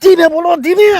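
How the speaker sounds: shrill-free panic, loud and high; panicky loud shush. Acted. Panicked hushing about trunk.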